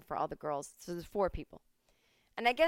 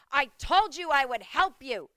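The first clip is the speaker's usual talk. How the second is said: louder, slow, higher scold; stern scolding; 7 semitones higher, 11 dB louder than usual. Retold or imitated. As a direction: lay down the law at the customer. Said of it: "turns around and goes".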